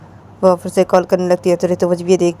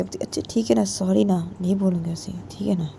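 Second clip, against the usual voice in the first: placating apology; 10 dB quieter than usual. Acted. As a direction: quietly placate an upset friend. Soft apology to angry friend. (धीरे से मनाते हुए)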